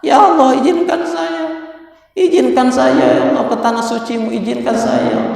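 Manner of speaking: high-pitched, pleading retold prayer; imitated tearful plea. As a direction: act out a man weepily begging God. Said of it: "Syekh Said ini menangis". (menirukan doa memohon sambil menangis)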